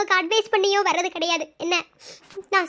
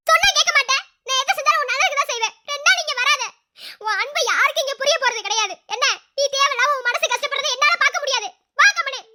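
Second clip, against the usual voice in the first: angry scolding; 7 semitones higher than usual. Acted. Older sister angrily scolding her brother to leave. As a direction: lash out to push him away.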